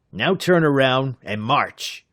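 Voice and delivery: slow, hard thug command; snapping order. A narrator's reading. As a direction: order them off, menacing. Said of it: "Packy snapped".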